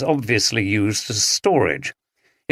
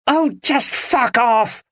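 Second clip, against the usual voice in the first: high, slow furious yell; wife snapping at her husband in anger; angry shout; 13 semitones higher, slower than usual. Acted. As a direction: spit it out in fury at him. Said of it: "shouted Andrea".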